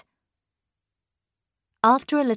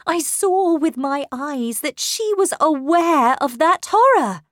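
high, loud, horrified testimony; agitated insistence; 8 semitones higher, 6 dB louder than usual. A narrator's reading. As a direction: insist on what you witnessed. Governess insists on the horror she saw.

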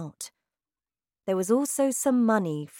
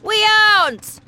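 loud, higher flat refusal; father refusing his daughter; blunt father; 12 dB louder than usual. Acted. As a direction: cut her off hard. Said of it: "her father said".

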